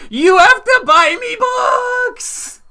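put-on very high, drawn-out kid shout; gloating son. Son who won the bet crowing at mom, high gloating shout. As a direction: crow it loudly like a victorious child. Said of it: "my son's just like".

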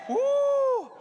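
high shouted woo; loud whoop. Real, not acted. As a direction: let out a joyful whoop. Preacher whoops to congregation.

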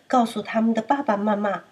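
ordinary delivery, nothing marked

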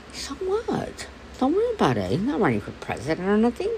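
high, heated comeback; hammed-up retort to a pushy voter; imagined angry retort. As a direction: snap back at the voter. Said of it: "That's what I wanted to say".

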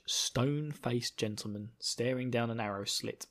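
ordinary talk, nothing marked

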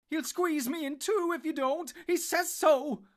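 high, loud frantic wail; panicked cry. A narrator's reading. Trapped hobbit warning that the tree will crush him, wailing. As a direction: wail it desperately, stretching the end. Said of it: "cried Merry".

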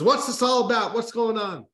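high, loud royal demand; the king barges in wanting answers; king startled by the commotion in his kitchen. A narrator's reading.

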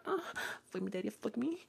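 high, hushed imitation moan; mock moaning. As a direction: act out a moan, high and very soft. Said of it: "I was moaning really fucking loud".

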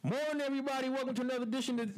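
high, loud, fast radio-host opening. Pretend. Host greets listeners to open the podcast, radio voice. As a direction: belt out the show welcome in a big radio voice. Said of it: "That's that radio voice".

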